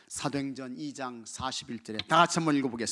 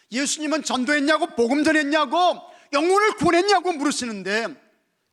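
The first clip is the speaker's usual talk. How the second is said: loud, high preaching cry; shouting the gospel from the pulpit; fiery preaching; 12 semitones higher, 13 dB louder than usual. Acted. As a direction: proclaim it with full force. Preacher at full force.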